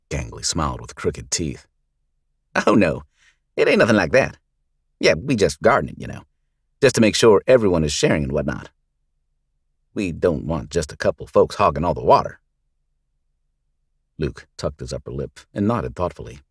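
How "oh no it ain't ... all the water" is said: sly thug. Acted. Gang leader explaining himself to the sheriff, sly mock innocence. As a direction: play it innocent, grinning.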